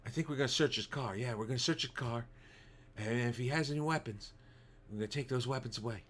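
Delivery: lightly voiced Seagal, soft and murmured; a hint of Seagal, mumbling mock cop lines; Steven Seagal impression. Streamer mocking Seagal's reality show lines, soft mock murmur. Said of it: "I can't do Steven Seagal".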